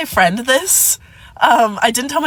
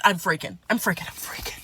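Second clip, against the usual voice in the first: breathless whisper, much softer; whispered panic; 4 semitones lower, 9 dB quieter than usual. Real, not acted. Telling friends how she panicked, in a whisper. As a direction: hiss it out under her breath. Panicked aside about her feelings.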